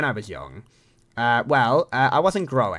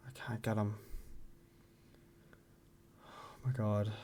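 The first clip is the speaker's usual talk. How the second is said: very soft, sinking into dismay; faint dread; 2 semitones lower, 16 dB quieter than usual. Acted. Reacting to needing bloods done, faint and defeated. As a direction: let the voice shrink away in dread.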